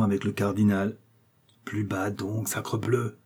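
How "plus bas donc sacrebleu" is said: hushed rebuke. Acted. Athos hushing a friend who talks too freely, hushed rebuke. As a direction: hush a friend in a softer voice.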